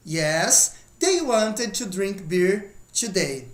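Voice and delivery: slow careful repeat; slow model sentence. Shown as a demonstration. Teacher repeating the sentence slowly for students. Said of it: "Então vamos lá, ó".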